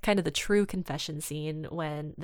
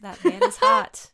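mock swoon; 9 dB louder than usual. Swooning over the character Arthur.

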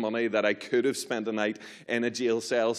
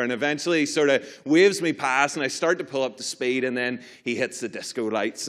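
ordinary talk

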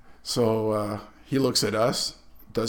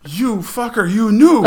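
high, indignant mock yell, getting louder; retold accusation; 9 semitones higher, 9 dB louder than usual. Lieutenant rounds on the ground crewman, mock outraged.

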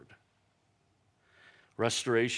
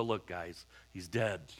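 lower, softer blunt statement; Jesus finally telling the disciples, lower and softer; blunt quiet; 4 semitones lower, 6 dB quieter, slower than usual. Retold or imitated. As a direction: drop the news flatly. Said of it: "finally has to look at him and go".

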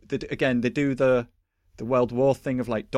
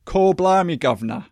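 a hammed-up Cockney "Call blimey, governor", higher and louder; mock cockney; 7 semitones higher, 8 dB louder than usual. A Londoner greets the American soldier in jokey Cockney. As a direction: put on a jokey Cockney street accent. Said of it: "he's all like".

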